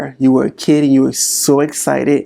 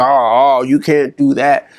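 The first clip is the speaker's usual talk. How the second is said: inner critic. Retold or imitated. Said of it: "was like".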